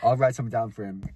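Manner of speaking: ordinary talk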